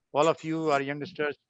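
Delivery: usual speaking voice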